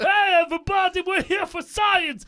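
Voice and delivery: lightly voiced chipper teacher; mock cheerful teacher. Mocking a cheerful school bus.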